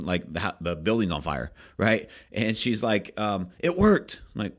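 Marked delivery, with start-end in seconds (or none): 3.6-4.2 high, loud excited cry. Retold or imitated. Agent running to manager with her news, high excited cry. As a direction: burst out with the good news.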